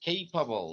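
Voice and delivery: slow, careful capable with a long "ay" diphthong, KAY-pa-bl; teacher modelling capable as KAY-puh-bl, slowly; correct English pronunciation. Shown as a demonstration. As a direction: stretch it out, capable as "keipabl". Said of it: "এর উচ্চারণটা এই".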